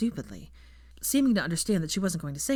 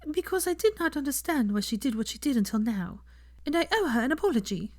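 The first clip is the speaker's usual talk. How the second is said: Fleur; 8 semitones higher than usual. A narrator's reading. Fleur asks Draco for time with Hermione, polite and higher.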